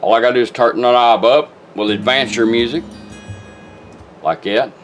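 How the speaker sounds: normal talking voice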